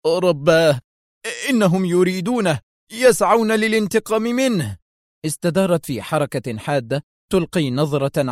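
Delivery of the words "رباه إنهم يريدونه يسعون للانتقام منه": trembling cry of fear. A narrator's reading. Qadri crying out in terror about the attackers. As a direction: cry out in fear as the danger closes in. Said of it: "صاح قدري وجسده كله يرتجف هلعا".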